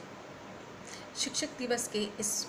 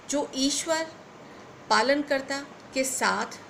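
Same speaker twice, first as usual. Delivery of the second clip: measured, phrase-by-phrase verse delivery; poem recitation; 3 semitones higher, 3 dB louder than usual. Acted. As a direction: declaim a poem with swelling emphasis. Recites her own poem.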